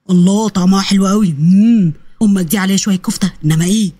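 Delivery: low, savouring voice with an mmm; father relishing food. Acted. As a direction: savour every bite in a low voice. (أب بيتلذذ بالأكل بصوت واطي)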